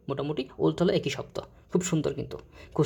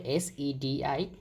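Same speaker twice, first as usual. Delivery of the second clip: slow, softer spelling of এস ই ডি আই; spelling out letters; 6 dB quieter, much slower than usual. Shown as a demonstration. Teacher spelling sedition for students, slowly. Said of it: "sedition যাকে বলে".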